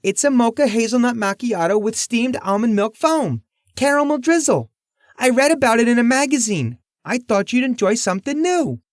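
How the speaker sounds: put-on high nervous young waitress; hopeful, timid diner waitress. Waitress explains drink.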